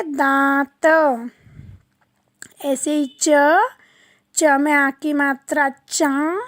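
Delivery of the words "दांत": दांत stretched very slowly with nasal आं; drawn-out nasal word. Shown as a demonstration. Teacher to children, slow nasal word. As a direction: stretch दांत through the nose. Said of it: "काय का चित्र है ये?".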